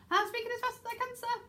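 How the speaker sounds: high-pitched rapid gabble; very fast. Shown as a demonstration. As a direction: rattle it off in a high voice. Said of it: "That's really very fast".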